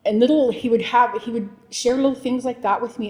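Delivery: normal talking voice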